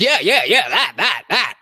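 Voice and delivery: mocking laugh. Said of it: "soft numbers like".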